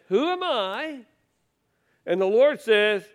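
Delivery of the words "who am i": high, small mock-plaintive Moses; mock whiny Moses. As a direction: play Moses as a bewildered little man, pitched up. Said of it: "let me translate that for you".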